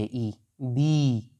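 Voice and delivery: बी with the ee drawn out very slowly; long ee vowel. Shown as a demonstration. Teacher shows students the long vowel, slow stretched ee. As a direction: hold the ई in बी long and steady. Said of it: "इसको हम थोड़ा लंबा बोलेंगे".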